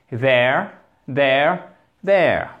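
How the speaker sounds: there said slowly and clearly, voiced th at the start; model English there. Shown as a demonstration. Teacher models there for French learners. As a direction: repeat there three times like a teacher. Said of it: "Exactement la même chose".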